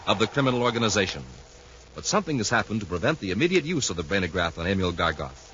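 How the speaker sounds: low, hushed storyteller voice; serial narration. A narrator's reading. Announcer narrating the radio adventure, low and grave. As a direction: set the scene gravely.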